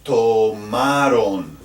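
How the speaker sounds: tomaron said slowly, stress on RON; slow demo word. Shown as a demonstration. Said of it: "and ustedes".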